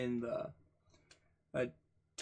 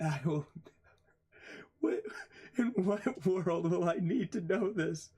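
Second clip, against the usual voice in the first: higher voice breaking up with laughter; laughing in disbelief; 6 semitones higher than usual. Real, not acted. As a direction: laugh helplessly through the question. Laughs at uselessness of the material.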